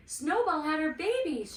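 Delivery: excited call. A narrator's reading. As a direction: call the family with the news. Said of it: "she called".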